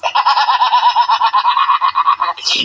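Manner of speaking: long real laugh. Real, not acted. Old woman still laughing at her joke, real laugh. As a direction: keep laughing freely.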